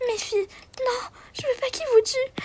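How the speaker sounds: voix brisée qui implore, plus aiguë; supplication en pleurs. Acted. Mère suppliant pour ses filles. (mère en larmes suppliant pour ses filles)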